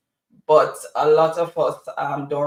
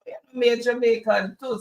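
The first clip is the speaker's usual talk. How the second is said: higher-pitched retold Jamaican patois; 8 semitones higher than usual. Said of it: "So we started talking patois".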